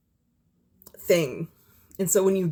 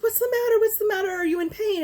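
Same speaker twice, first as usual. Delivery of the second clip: put-on much higher, caring Creole-accented nurse; faster than usual. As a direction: imitate a kind nurse's Creole accent, pitched way up. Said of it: "almost Creole sounding accent".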